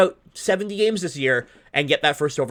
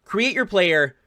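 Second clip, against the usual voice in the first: upbeat, pitched-up sponsor pitch; sponsor ad read; 3 semitones higher than usual. Acted. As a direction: sell the league like a friendly ad.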